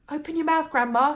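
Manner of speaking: put-on boy rushing to douse grandma; urgent cry. A narrator's reading. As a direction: call out urgently with the jug. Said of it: "he cried".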